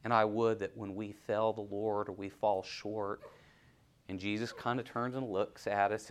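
ordinary talk

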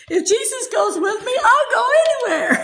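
high, exuberant declaration with a laugh in it; joyful declaration. Shown as a demonstration. Preacher repeats the song God gave her, joyful declaration. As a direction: proclaim it gladly, pitched well up.

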